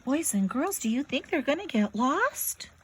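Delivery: usual speaking voice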